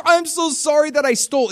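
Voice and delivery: very high fake apology; mock sorry. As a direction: whine a showy apology. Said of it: "it's not just".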